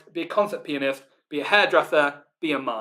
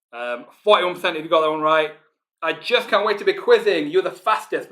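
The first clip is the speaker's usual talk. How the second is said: ordinary delivery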